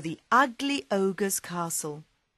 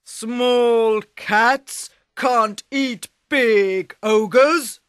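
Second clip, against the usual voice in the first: slow, loud ogre voice; scornful ogre; 4 semitones higher, 9 dB louder, much slower than usual. Acted. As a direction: boom it like a smug giant. Ogre scoffs at cat.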